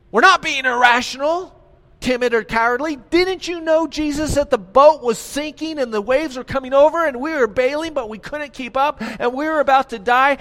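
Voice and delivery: high, loud, offended protest; frightened sailors shouting back, stung; indignant disciples. Acted. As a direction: protest as if insulted by the accusation.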